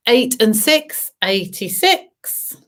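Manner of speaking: sing-song number calling; bingo caller. Acted. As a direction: call the numbers like a bingo host. Bingo calls.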